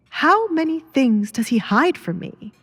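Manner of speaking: a mild hint of a wife doubting her husband; wondering wife. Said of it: "she wondered".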